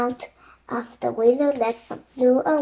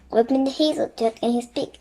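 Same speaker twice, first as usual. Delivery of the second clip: usual speaking voice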